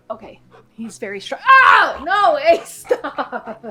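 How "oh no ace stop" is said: loud, high yelp at the dog; startled shout at dog. Real, not acted. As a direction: cry out as he yanks. Dog pulling hard.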